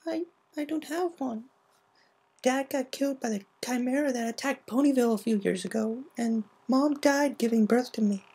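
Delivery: high, soft, hesitant filly voice; sad. Acted. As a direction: hold back tears. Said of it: "Scootaloo hesitated".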